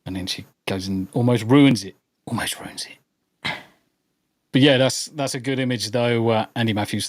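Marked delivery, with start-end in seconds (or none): 2.3-3.5 breathy whispered repeat. Real, not acted. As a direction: whisper it as an aside.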